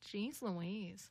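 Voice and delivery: soft parrot imitation. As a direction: say it softly like a parrot. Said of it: "My parrot would sound like".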